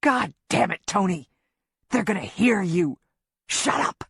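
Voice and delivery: tense, urgent rebuke; fury mixed with fear; sharp rebuke ending in a harsh hiss; panicked anger. Acted. Griff panicking at her crying.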